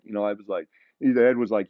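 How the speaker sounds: normal talking voice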